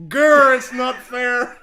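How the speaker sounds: mock jealous growl at the cat. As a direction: growl it like a sulky child. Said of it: "do the voice".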